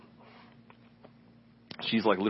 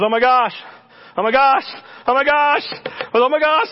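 rising, louder excited cries, building higher each time; excited outcry; 13 semitones higher, 7 dB louder than usual. Retold or imitated. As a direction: cry out in growing amazement. Woman set free, retold.

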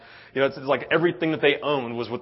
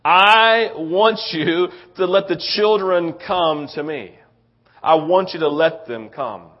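Jesus correcting them; 4 semitones higher, 5 dB louder than usual. Retold or imitated. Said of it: "He's like".

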